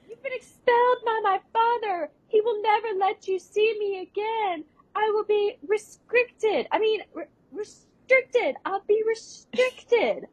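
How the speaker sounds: bad actress line reading. Imitating Miranda badly performing, hammed-up high delivery. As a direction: overact each line like a terrible school actress.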